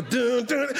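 high vocalized instrument hits; mock organ and drum stabs; imitated church band riff. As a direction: sing out the backing band's hits. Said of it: "they help you out".

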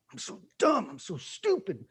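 high-pitched mock self-scolding; anger at self. Person berating themselves over a mistake, angry self-reproach. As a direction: act out beating yourself up, pitch jumping high. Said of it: "acting out of anger towards themselves".

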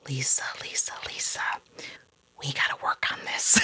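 imitated whispered reproof; hushed colonel. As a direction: take her aside and whisper it, with pauses. Colonel gently takes her aside.

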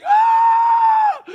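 sudden high wailing cry; imitated mourner scream. Preacher voicing mourners at funeral, high wailing scream.

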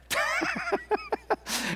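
a quick amused laugh; high short laugh. Real, not acted. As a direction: laugh at your own joke. Laugh after the bit.

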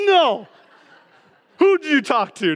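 high-pitched mock parental alarm; mock outrage. Dad to daughter who loves presents, mock outrage. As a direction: react in pretend horror, pitch jumping up.

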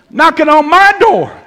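high-pitched, loud indignation; mock outrage. Veteran prankster affronted at being pranked himself, mock outrage. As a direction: cry it out as if deeply affronted.

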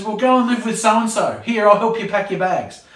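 put-on a parent sarcastically offering to help the child move out; sarcastic parent. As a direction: call the kid's bluff. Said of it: "the response from the parent is".

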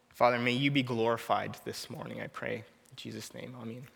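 gentle pastoral prayer, dropping to a hush; opening prayer. Acted.

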